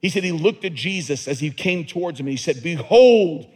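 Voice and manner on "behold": proclaiming. Acted. John the Baptist proclaiming Jesus.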